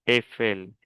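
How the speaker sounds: "Eiffel" said "èfel", no I glide; open E. Shown as a demonstration. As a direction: begin "Eiffel" on a pure open E. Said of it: "es E". ("Eiffel" con E abierta, sin I)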